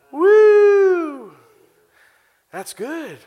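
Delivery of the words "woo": high, loud whoop of joy; exultant woo. Acted. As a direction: let out a joyful whoop. Exultant shout.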